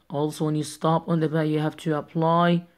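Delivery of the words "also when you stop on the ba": ordinary talk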